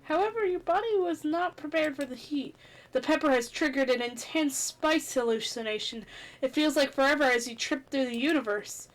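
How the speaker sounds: ordinary talk, nothing marked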